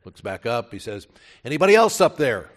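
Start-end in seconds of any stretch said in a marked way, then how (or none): 1.4-2.5 fast, loud, high call for someone else; panicked shout. Retold or imitated. Man turns down God's advice, calling up fast and loud. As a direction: shout it quickly, hoping for another answer.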